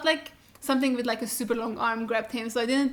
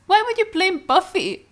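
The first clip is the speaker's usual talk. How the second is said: high, loud, outraged; outraged call-out; 8 semitones higher, 5 dB louder than usual. Real, not acted. Vlogger yells at Joyce for blaming Buffy, outraged. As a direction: shout at her in disbelief.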